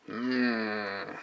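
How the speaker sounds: ordinary talk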